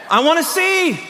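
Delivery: high-pitched eager cry; acted plea. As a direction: voice the blind man's wish, pitched high. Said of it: "And he said".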